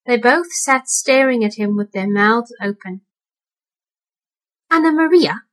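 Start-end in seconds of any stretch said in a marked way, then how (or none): 4.7-5.4 put-on old rat calling. A narrator's reading. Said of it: "said the old man rat".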